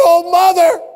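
high anguished scream of a grieving son; screamed grief. Retold or imitated. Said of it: "Screamed out".

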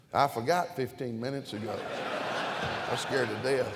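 loud, high preacher's cadence; full-force preaching. Real, not acted. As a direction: preach it out big to the congregation.

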